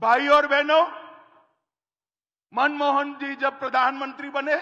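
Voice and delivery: loud fiery campaign oratory; hindi political rally speech. Acted. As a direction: rouse the crowd from the stage.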